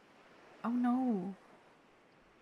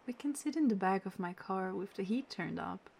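ordinary talk

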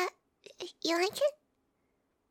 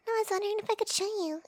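usual speaking voice

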